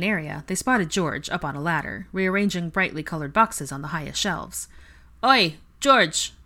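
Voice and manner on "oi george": loud, high call across shop. A narrator's reading. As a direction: call up to him on the ladder. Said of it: "called Harry".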